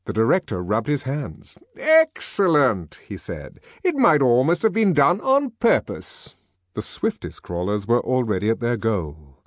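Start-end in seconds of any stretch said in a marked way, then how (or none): 1.7-3.0 high, loud, drawn-out delight; delighted director. Acted. Director delighted, rubbing his hands. 3.8-6.3 high-pitched director's voice; pleased director remarking with relish; delighted director. Acted.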